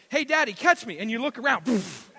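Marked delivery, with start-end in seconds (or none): 0.1-1.0 put-on excited child calling to dad, higher and louder; kid calling out. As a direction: call out to daddy with glee. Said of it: "he's done this to me before".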